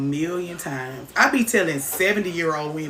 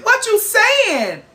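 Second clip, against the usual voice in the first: shocked question; 9 dB louder, much slower than usual. Real, not acted. Outraged at comment.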